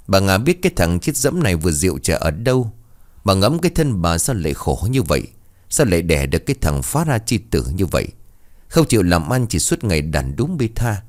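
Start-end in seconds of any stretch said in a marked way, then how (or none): none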